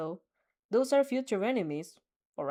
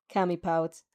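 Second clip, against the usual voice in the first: usual speaking voice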